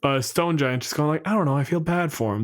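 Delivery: usual speaking voice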